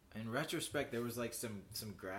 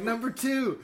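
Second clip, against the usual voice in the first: high, loud, amused announcement; gleeful reply; 11 semitones higher, 11 dB louder, slower than usual. Retold or imitated. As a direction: proudly announce the sequel. Quoting own reply, laughing.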